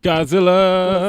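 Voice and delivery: high, loud, hugely drawn-out call; mock monster announcement. Co-host echoing the next topic, playful drawn-out call. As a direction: stretch the name out, hammed-up and booming.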